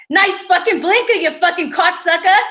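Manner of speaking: angry Boston driver, pitched high; Boston accent. Acted. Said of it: "That was my Boston accent impression".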